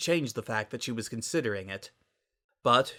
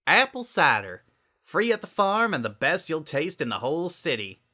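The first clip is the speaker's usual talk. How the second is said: Applejack voice; 5 semitones higher, 3 dB louder than usual. A narrator's reading. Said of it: "Applejack drawled".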